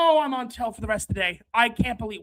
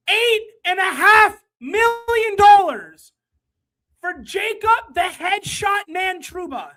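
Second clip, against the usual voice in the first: loud, slow outrage at the price; furious, stretched-out complaint; outraged; 6 semitones higher, 5 dB louder than usual. Real, not acted. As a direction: spit out the number in disbelief. Streamer raging at a signing.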